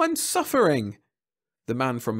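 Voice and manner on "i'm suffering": high-pitched wail of complaint; anguished protest. A narrator's reading. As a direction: protest your misery to the official. Jeb protests his misery.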